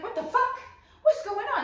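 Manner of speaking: very high mock-bewildered cry; bewildered outburst. Voices bewildered abandoned partner.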